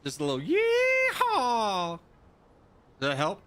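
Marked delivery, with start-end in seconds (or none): 0.4-2.0 a slow, loud, high cowboy yeehaw, stretched long; drawn-out yeehaw. Pretend. Streamer giving chat another yeehaw, slow and loud. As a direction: hold the yeehaw like a cowboy calling across the range. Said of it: "Does that build your immersion?".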